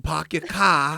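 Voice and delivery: put-on Boston accent. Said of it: "You sound like".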